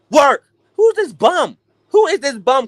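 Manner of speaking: high, indignant mock viewer; outraged fan. Playing a stunned viewer of Manny's fight, indignant. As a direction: voice a fan shocked at the upset.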